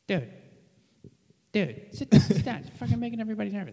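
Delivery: high-pitched, loud, rushed impression; Bobby Kelly. Comic imitating a fellow comedian ordering him to sit, high-pitched nagging impression. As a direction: bark it high and fast, fed up. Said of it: "Do the impression".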